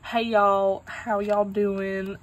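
loud, drawn-out Southern greeting; Southern US accent, hammed-up and forceful; aggressive Southern accent. Shown as a demonstration. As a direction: put on a pushy Southern twang, loud and stretched. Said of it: "a aggressive Southern".

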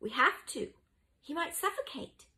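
high-pitched, urgent argument; urgent insistence. A narrator's reading. Mucius wants to open the wardrobe, urgently. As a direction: press the point urgently. Boys argue over opening wardrobe.

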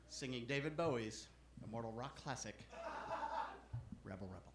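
low, soft announcement; hushed repeat. Acted. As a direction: drop low and quiet to set up the act. Hushed repeat before the impression.

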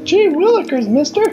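put-on very high, gosh-golly boy voice; an eager little kid thanking a stranger; wholesome 1950s kid. Playing the game's wholesome kid.

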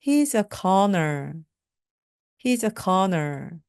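slow, careful model sentence; deliberate classroom pronunciation; slow teaching drill. Shown as a demonstration. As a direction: say it slowly for learners to repeat. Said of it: "slow model sentence drill".

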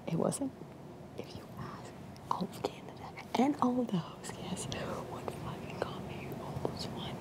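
hushed whisper. Real, not acted. Whispering throughout.

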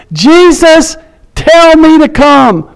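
high, drawn-out imitated call; retold calling out. Peter asking Jesus for his word, imitated calling out. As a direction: stretch the plea out as a high call. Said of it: "he yells out and says".

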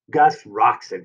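ordinary delivery

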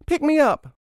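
put-on high, loud little-child plea; child crying out. A narrator's reading. Pea child begging to be lifted, crying out. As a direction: cry out to be carried. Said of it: "Another cried".

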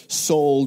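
"Soul" said loud and high in a northern accent. Shown as a demonstration. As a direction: hit "Soul" hard to clarify the word. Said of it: "this is my northern accent here".

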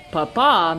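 Papa stressed on its second syllable; last-syllable stress. Shown as a demonstration. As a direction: land on the final PA of Papa. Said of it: "emphasis is on the last syllable".

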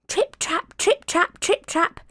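high trip-trap; hoof steps. A narrator's reading. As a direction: middle goat's hooves tapping. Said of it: "went his hooves".

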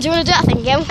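usual speaking voice

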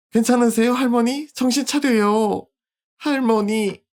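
high, frightened calling out; panicked girl. Acted. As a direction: cry out for grandma in alarm. Girl finds grandma.

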